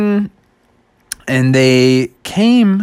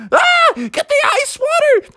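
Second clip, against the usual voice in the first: very high panicked shout; panicked yelling. Acted. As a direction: cry out for ice water, still burning. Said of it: "and he's like".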